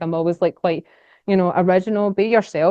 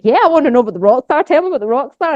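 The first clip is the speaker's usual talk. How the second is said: fast, higher and louder eager demand; eager viewers; 6 semitones higher, 6 dB louder, faster than usual. Retold or imitated. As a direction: play the hooked viewers begging to know. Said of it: "they're like".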